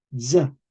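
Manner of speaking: "z" slowly said as dza; medieval Z sound. Shown as a demonstration. Teacher stresses an important rule, "z" voiced as dza. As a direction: buzz "z" as d+z, then a. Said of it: "se pronuncia z".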